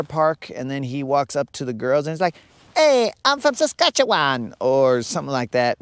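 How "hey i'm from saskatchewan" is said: high, loud wussy guy voice. Retold or imitated. Built guy introducing himself to girls at the water park, wussy voice. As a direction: put on a much higher, wimpy man's voice. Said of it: "his voice was all wussy".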